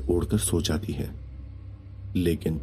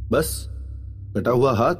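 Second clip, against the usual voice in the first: raised-pitch, told-you-so rebuke; scolding young man; 6 semitones higher than usual. A narrator's reading. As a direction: rub in the warning with exasperation. Vishal scolds frightened guest.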